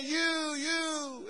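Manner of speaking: high, drawn-out echoing call; high hollered echo. Retold or imitated. Shouted call echoing across distance.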